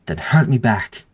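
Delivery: loud furious demand; screamed. A narrator's reading. Goading the beaten youth to fight, screamed demand. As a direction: hurl it as a challenge. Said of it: "they screamed".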